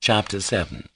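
usual speaking voice

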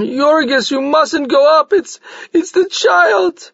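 high, stammering, slowing plea; frantic pleading. A narrator's reading. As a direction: blurt out the bad news. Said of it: "she rushed on".

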